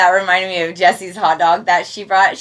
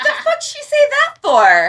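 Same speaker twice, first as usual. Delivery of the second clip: much higher, incredulous squawk; mock baffled onlooker. Bystanders react to Jessie's outburst, squawking. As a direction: act scandalised by her outburst. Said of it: "everybody's like".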